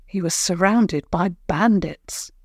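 ordinary delivery, nothing marked

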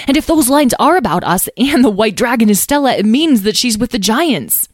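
high, lively, loud deduction; excited. A narrator's reading. Tom works out where the dragon is, excited. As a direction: race towards the conclusion with eager energy. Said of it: "said Tom excitedly".

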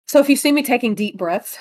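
ordinary delivery

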